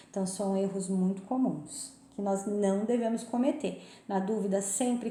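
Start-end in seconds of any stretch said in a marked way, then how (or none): none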